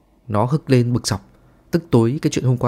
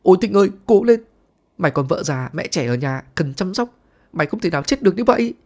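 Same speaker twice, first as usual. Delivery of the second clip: hammed-up weepy wail, high pitched; fake-cry over a friend's body, overdoing the grief; theatrical fake mourning, pitch jumping high; mock sobbing lament; 8 semitones higher than usual. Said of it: "Đạt sụt sùi".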